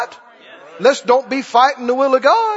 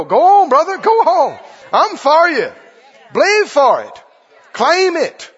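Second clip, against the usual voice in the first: higher, urgent cheering-on; rousing encouragement; 4 semitones higher, 4 dB louder than usual. Acted. Believer urging a brother on in faith, rousing shouts. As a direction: call out like you are urging him forward.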